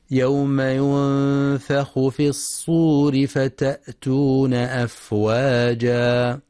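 Quran recitation. Shown as a demonstration. Teacher recites the verse for students, slow solemn chant. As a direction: intone each phrase with long vowels.

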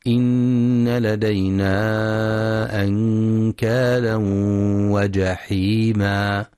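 slow, measured tajweed chanting; slow Quranic recitation with music behind. Shown as a demonstration. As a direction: recite each word drawn out and melodic.

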